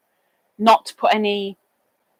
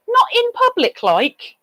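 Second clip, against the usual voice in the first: high mock echo; 12 semitones higher than usual. Real, not acted. Mocking echo of a comment to the chat. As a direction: leap much higher on not.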